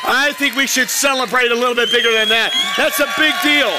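high, loud celebrating; excited. Real, not acted. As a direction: lift it up, cheer them on. Pastor cheering the response.

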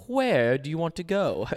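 a hammed-up Southern boss asking where the customer wants to go; imitating a Southern accent. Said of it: "the worst Southern accent I've ever heard".

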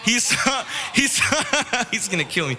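fighting real laughter to get the story out; cracking up. Real, not acted. Preacher telling on his friend to the youth, cracking up.

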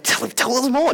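a mock-excited crowd; eager begging. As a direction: beg for the secret. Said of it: "the Corinthians are like".

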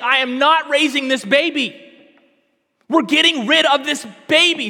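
mock shouting at the crying daughter; retold scream. Preacher retells a mother yelling at her daughter, loud mock shout. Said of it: "she's screaming expletives and saying".

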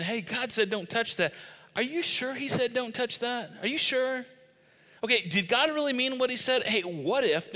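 high, coaxing crafty tempter; crafty, wheedling questioning; Satan. Retold or imitated. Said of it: "Satan's crafty".

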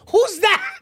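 high, loud astonished question; mock child's bafflement. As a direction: squeal it like a stunned kid. Child shown picture of Jesus.

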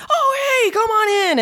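mock welcoming yell; cheery shout. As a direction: call out a welcome across the lot. Hosts greet with flashlights.

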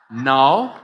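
ordinary talk, nothing marked

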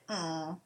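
soft fading hum; power down. Retold or imitated. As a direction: let the hum die like a machine. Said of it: "that was my power down sound".

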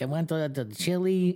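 squeaky little dude ordering; high timid mock voice; high mimicked little guy. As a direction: pitch it up as the timid coworker. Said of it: "he's like".

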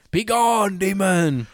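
high, drawn-out cry banishing a demon; hammed-up exorcism command; mock exorcist. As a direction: cast it out in a theatrical high voice. Mock exorcism of possessed cat.

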